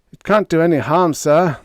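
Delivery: pleading. A narrator's reading. Officer pleading with his commander, fast and earnest. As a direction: beg the commander to let it happen. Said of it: "he pleaded".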